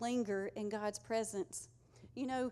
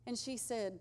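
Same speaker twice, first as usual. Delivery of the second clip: ordinary talk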